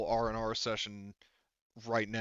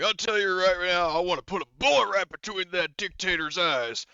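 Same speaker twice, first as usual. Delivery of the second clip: loud, higher-pitched sergeant's bark; growing fired up, shout it out; Horgus, drill sergeant; 7 semitones higher, 7 dB louder than usual. Acted. Horgus vowing to shoot the dictator, loud and fired up. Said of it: "Horgus by this point is".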